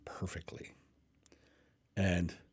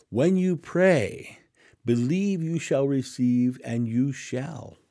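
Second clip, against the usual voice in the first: fervent preaching; 3 semitones higher, 4 dB louder, slower than usual. Acted. Preaching the promise.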